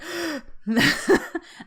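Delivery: sharp audible gasp; big noisy breath in; loud breath intake. Shown as a demonstration. Demonstrating their own on-camera breathing to viewers, loud breath intake. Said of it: "I breathe. I take, like".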